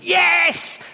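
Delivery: high, loud cry of triumph; mock goal cheer; goal celebration. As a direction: cheer as if your team just scored. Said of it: "I went".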